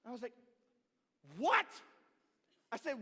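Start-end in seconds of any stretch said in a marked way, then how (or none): 1.4-1.7 loud, high disbelieving what; big brother stunned, loud and high; shocked what. Retold or imitated. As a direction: blurt it out in disbelief.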